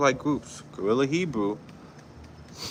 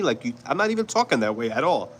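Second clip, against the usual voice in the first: ordinary talk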